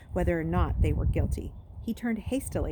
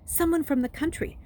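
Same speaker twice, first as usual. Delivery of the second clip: higher, louder, eager to help; hopeful explaining; 10 semitones higher, 4 dB louder than usual. Acted. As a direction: hoffnungsvoll erklärend. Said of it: "Trey explained hopefully".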